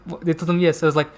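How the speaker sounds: ordinary delivery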